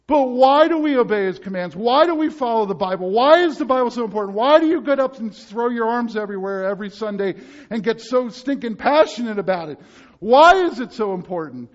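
loud, driving sermon questions; rising preacher's fervor; preaching at full force. Acted. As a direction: hammer each question home to the congregation.

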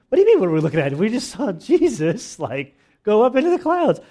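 loud, high, stunned disbelief; what do you mean, we just saw him go up; incredulous outburst. Acted. Disciples answering the angel in loud, high disbelief.

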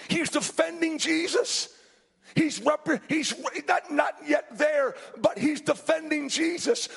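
driving pulpit storytelling; animated preaching. Real, not acted. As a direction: retell the Bible scene with mounting urgency.

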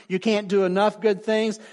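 ordinary talk

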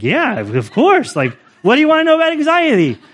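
high-pitched comic retort, speeding up; mock incredulous. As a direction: play up the obviousness, voice jumping high. Acted reply to anxiety question.

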